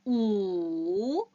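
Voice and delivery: 우 drawn out and rounded; 우 held long with round lips; a long, sustained 우. Shown as a demonstration. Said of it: "같이 갈게요".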